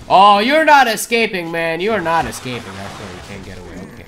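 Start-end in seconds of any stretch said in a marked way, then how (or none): 0.1-1.5 slow loud taunt. Real, not acted. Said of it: "repeated taunt". 1.5-1.8 loud, slow gloating taunt; taunting shout. Real, not acted. Chasing an enemy in game. 1.8-2.7 taunt repeated. Real, not acted. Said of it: "repeated taunt".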